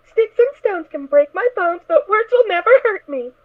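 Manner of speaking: ordinary delivery, nothing marked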